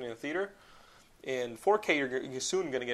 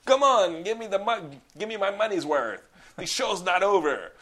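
loud, higher villain taunt; hammed-up fight taunt, louder and higher; taunting shout; 7 semitones higher, 9 dB louder than usual. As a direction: bellow the taunt at the beaten hero. Voicing Van Damme mid-fight.

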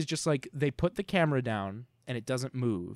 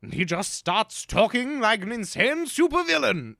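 a touch of a mad villain, slow and higher; hint of supervillain; 7 semitones higher, 4 dB louder than usual. Describing the Invisible Man going mad, in a light villain voice.

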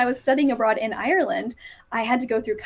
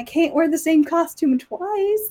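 higher, drawn-out mock dismay; mock protest; 5 semitones higher, slower than usual. Costume lover about repeating an outfit, mock dismay. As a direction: protest slowly with playful self-mockery. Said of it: "'cause I'm like".

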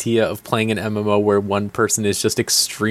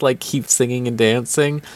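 ordinary talk

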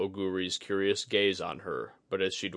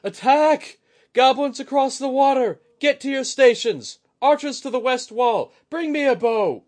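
much higher, far louder battle alarm; yelling at the top of his lungs; 12 dB louder than usual. A narrator's reading. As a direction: bellow orders across the wall. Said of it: "sent out a yell at the top of his lungs".

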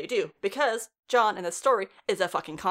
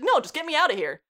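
retold plea, higher and louder; raised-voice begging, retold; urgent plea; 7 semitones higher, 5 dB louder than usual. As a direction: blurt out a mock desperate plea.

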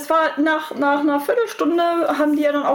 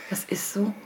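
whisper; 3 semitones lower, 7 dB quieter than usual. Real, not acted.

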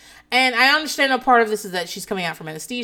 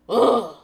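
frustrated groan; 6 dB louder than usual. Real, not acted. Host who has lost the topic, frustrated groan. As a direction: groan at your own lost train of thought.

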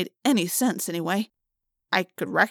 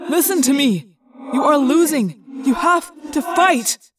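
urgent shouting; 7 semitones higher, 7 dB louder than usual. Acted. Urging friend to fight morph.

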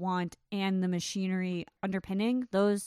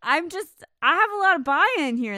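loud, higher, thoroughly won over; delighted enthusiasm; 7 semitones higher, 8 dB louder than usual. Real, not acted. Co-host sold on the supposedly bad film, loud enthusiasm. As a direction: gush loudly, pitch swinging up.

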